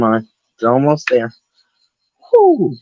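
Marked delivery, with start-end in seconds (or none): 2.3-2.8 high, loud whew of relief; relieved whew. Real, not acted. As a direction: blow out in relief. Relief after a close tear.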